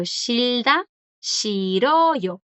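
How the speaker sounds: "shilda" rising, then "shiroyo" stretched and falling; silent consonant demo. Shown as a demonstration. Teacher repeats the example for students, careful pronunciation demo. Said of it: "No suena en ninguna".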